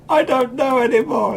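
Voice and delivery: high, loud mock despair; mock breakdown. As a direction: wail it like you're falling apart. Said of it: "I'll be like".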